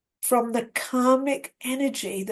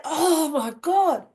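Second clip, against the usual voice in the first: slow, higher, drawn-out exasperation; exasperated; 4 semitones higher, slower than usual. Retold or imitated. Woman hearing the newcomer is lost, exasperated. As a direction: imitate someone losing patience, stretching the words. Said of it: "then she sort of gets composed".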